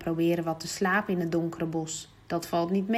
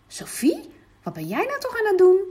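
a much higher surprised call to Sophie; startled father calling out; 11 semitones higher, 7 dB louder than usual. Acted. Papa finds Sophie at the window, calling out in surprise.

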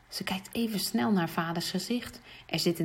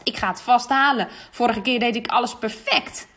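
a lightly voiced confident boy, louder; boastful boy; 3 semitones higher, 5 dB louder than usual. Jan bragging to classmates before the test, confident. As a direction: brag a little, sure of yourself.

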